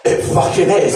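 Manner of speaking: ordinary talk, nothing marked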